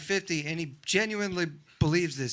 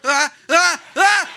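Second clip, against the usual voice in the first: loud, high "ah"s building up; excited pre-sneeze gasps; 8 semitones higher, 10 dB louder, much slower than usual. Acted. Body about to sneeze, gasps getting more excited. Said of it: "the more excited it gets".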